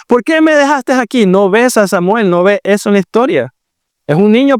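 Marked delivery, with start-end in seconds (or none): 0.1-1.2 put-on high child's voice, hurt and reproachful; little boy asking why he was left, high and hurt. Abandoned child reproaches his parents, high childish voice.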